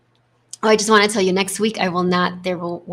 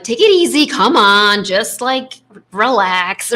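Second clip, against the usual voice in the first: loud, impatient telling-off, pitched up at first; harsh self-talk; 2 semitones higher, 6 dB louder than usual. Shown as a demonstration. Coach shows the wrong tone for self-talk, loud and impatient. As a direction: beat yourself up with soothing words. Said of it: "So it's not like".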